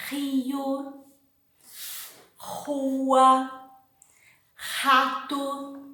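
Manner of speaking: Portuguese R, rio, rua, rato with a throaty h-like R. Shown as a demonstration. Teacher demonstrating the Portuguese initial R. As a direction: rasp the R from the throat like the h in hospital. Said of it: "As in".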